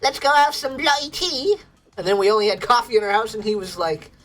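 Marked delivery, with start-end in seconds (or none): 0.0-1.6 higher, hammed-up British accent; mock British accent. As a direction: lay on a jokey British accent for the mates. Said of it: "he's British".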